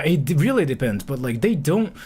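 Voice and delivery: ordinary delivery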